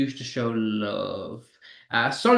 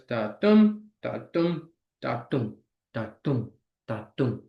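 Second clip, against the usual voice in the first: thud it out steadily, sinking lower; heartbeat imitation; 3 semitones lower, slower than usual. Teacher imitating a heartbeat felt in the chest, steady beat. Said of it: "feel your heartbeat".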